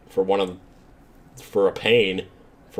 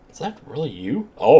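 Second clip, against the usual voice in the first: ordinary talk, nothing marked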